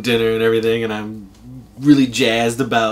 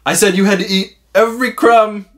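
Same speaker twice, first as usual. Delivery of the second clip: higher, louder mock scolding; mock stern uncle; 10 semitones higher, 6 dB louder than usual. Uncle telling the boy he broke the crumb rule, mock stern. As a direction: lay down the rule with fake gravity. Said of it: "And he goes".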